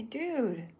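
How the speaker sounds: high, loud, drawn-out dude; character's dude. Acted. Chuck addressing the tire-eater, a loud high dude. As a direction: call out the dude the way he'd say it. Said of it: "'Cause that's how he would say it".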